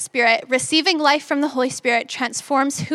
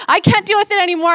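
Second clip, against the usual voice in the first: higher, louder, strained muttering at the wait; losing patience, words spilling out faster; irritated self-talk; 5 semitones higher, 4 dB louder than usual. Retold or imitated. To herself while her dad blocks the coffee, irritated and strained. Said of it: "automatically I'm irritated".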